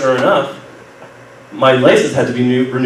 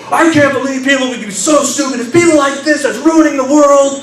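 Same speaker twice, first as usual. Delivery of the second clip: high-pitched irate woman's yell; imitated angry yelling; 13 semitones higher than usual. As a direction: mimic a furious woman ranting, pitch way up. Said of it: "She starts yelling".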